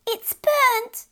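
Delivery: put-on a small fairy child crying for mother; sobbing fairy child. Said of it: "sobbed the little fairy child".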